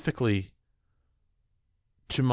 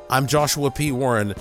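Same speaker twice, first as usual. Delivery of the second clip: dramatic radio announcer read; ominous late night radio; 3 semitones higher, 5 dB louder than usual. Acted. Podcast station ident and break.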